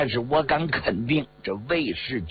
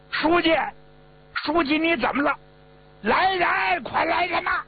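very high, frightened call for help; high, urgent cries of alarm; panicked shout. A narrator's reading. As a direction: shout for help over a collapsed superior. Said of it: "他一喊".

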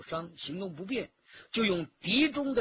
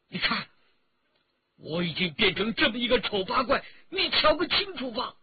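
raised, anguished voice climbing very high; bitter outburst; 6 semitones higher, 5 dB louder than usual. A narrator's reading. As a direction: burst out in self-loathing, louder to the end. Cornered man bitterly bares scarred face. (悲愤自嘲，越说越高声)

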